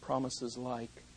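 very soft, low, slow promises; tender hush. Acted. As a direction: whisper the promise like a comfort at a bedside. Soft quoted promises of God.

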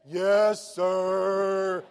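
loud, long drawn-out answer; caller's dutiful reply. Retold or imitated. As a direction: imitate a captive listener stretching out each word.

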